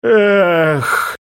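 high anguished ghost moan; ghostly moan. Acted. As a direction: moan like a lost soul. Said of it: "издал будоражащий стон, полный безнадёжной тоски".

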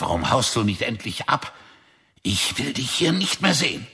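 rising into a furious yell, pitch climbing high; angry outburst. Acted. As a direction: drive him out of the cabin. Father quarrels with son over cookies. (wütender Ausbruch, immer höher)

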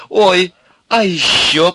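high, loud, slow squeal of delight; delighted begging. A narrator's reading. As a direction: beg like a thrilled girl. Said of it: "Милка засмеялась и захлопала в ладоши".

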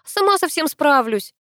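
high-pitched, testy defiance. A narrator's reading. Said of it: "я могу повысить голос".

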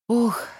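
heavy weary sigh. A narrator's reading. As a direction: let out a tired sigh. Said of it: "вздохнула я".

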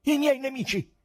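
loud, high, frantic accusation; high-pitched, horrified shout; terrible voice. A narrator's reading. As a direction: cry it out high in a dreadful voice. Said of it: "rispose Nicanor Ivanovic con voce terribile".

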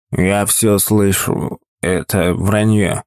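put-on slow, slurred drunk words; drunk slurring. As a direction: slur it like a drunk man. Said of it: "Заплетающимся языком заговорил Игорь".

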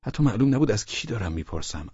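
ordinary delivery, nothing marked

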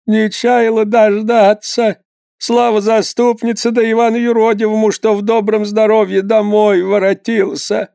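высокий, протяжный старушечий голос; запричитай по-старушечьи, нараспев; голос старой матери. A narrator's reading. (мать благодарит святых за сына, высоко)

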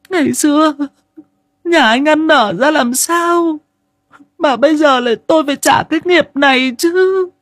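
very high, anguished woman's wail; a mother broken by loss crying out at her fate; grieving wife. A narrator's reading. Grieving wife cries out to husband, high anguished wail.

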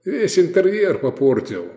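mock-aggrieved chiding; calm mockery. A narrator's reading. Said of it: "спокойный и насмешливый".

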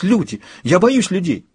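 high-pitched fearful outburst; frightened. A narrator's reading. Woman thrashing at the mention of people, frightened.

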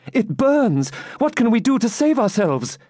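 very high, frantic plea; desperate high-pitched heroine; panicked alarm. A narrator's reading. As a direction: beg for a way out of the danger. Rebecca panics at the fire.

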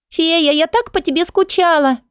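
put-on high child voice, missing her; little girl voice. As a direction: play a small girl.

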